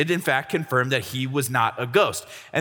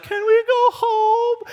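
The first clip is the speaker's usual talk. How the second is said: put-on very high, dragged-out kid whine; mock bored child pleading to leave; whiny little sister; 4 dB louder, much slower than usual. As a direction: whine it slowly, way up in pitch. Little sister whining on fishing trip.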